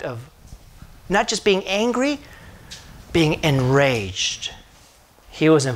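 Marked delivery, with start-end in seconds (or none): 3.1-4.5 slow emphasis. Acted. Preacher stresses rage.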